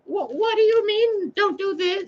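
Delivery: put-on very high, childish squeaky voice asking Mother Sophia; mock dim-witted follower, high and squeaky; squeaky confused human. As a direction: play a clueless squeaky human asking for the rule.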